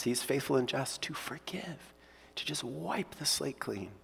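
whispered, very soft reassurance. Real, not acted. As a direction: breathe it gently to the congregation. Pastor whispers assurance of forgiveness.

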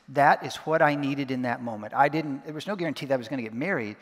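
ordinary talk, nothing marked

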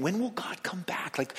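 hushed whisper of longing; whispered wondering. Acted. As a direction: whisper it like a private hope.

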